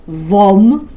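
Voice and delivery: ordinary delivery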